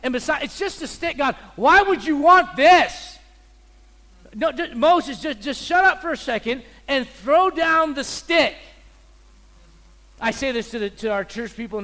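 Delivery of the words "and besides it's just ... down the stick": loud impassioned sermon voice; preaching at full force. Acted. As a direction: preach it hard and loud, voicing the scene. Preacher voicing Moses and God.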